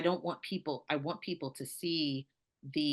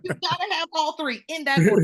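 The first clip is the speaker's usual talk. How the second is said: much higher and louder, playful insistence; emphatic joke; 13 semitones higher, 10 dB louder than usual. Real, not acted. Telling the host the Southern order of things, playfully emphatic. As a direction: lay down the rule with a grin. Joking about Southern priorities.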